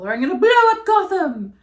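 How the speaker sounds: very high, loud cartoon threat; hammed-up villain shouting a threat; villainous threat. Mocking the Riddler's senseless plan, high loud villain threat.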